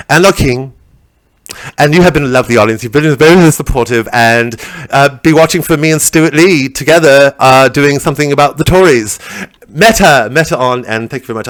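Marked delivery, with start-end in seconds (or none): none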